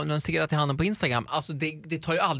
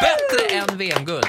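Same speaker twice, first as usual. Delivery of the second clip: high, slow hyped praise; hype; 11 semitones higher, 4 dB louder, slower than usual. Acted. Host hyping the guest, high and slow. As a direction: oversell the applause.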